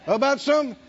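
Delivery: usual speaking voice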